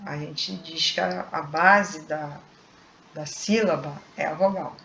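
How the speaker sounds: ordinary delivery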